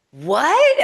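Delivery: high and loud, disbelieving cry; astonished what. Retold or imitated. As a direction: blurt it out in sheer disbelief. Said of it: "And I'm like".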